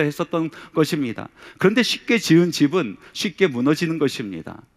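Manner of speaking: high, loud, driving sermon delivery; full-force preaching. Acted. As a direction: preach it hard to the congregation.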